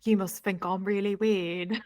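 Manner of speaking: put-on a British accent, dreamily brooding. Friend mocks a movie heartthrob in a British accent. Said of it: "Your accent is so good".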